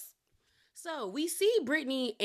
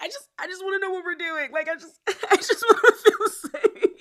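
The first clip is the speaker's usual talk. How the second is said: fake teary plea; 9 semitones higher, 6 dB louder than usual. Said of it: "voice crying thing".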